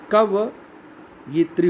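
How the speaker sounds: कवयित्री drawn out slowly, syllable by syllable; slow careful spelling. Shown as a demonstration. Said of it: "कवयित्री नहीं होता है दोस्तों".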